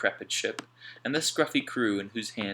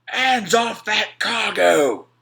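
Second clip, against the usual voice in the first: much louder and higher, slow furious yell; Slank yelling; 12 semitones higher, 13 dB louder, much slower than usual. A narrator's reading. Slank yelling at Alf to get off the cargo, slow loud yell. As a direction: yell him off the trunk. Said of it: "Slank yelled".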